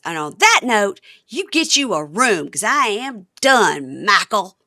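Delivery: slow, emphatic mock ultimatum; fed up. Wife telling husband she is done, fed up.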